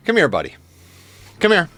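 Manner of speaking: high call to the cat; calling a pet. Real, not acted.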